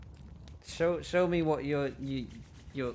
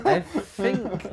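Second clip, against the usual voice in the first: high and loud "I think"; startled interjection; 9 semitones higher, 9 dB louder than usual. Acted. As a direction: jump in, alarmed. Player character cuts in loudly.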